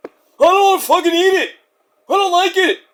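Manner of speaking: high, put-on rock star whine; hammed-up impression of a complaining dad. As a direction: protest in a high imitation voice. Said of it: "That's not what you said on the Osbournes".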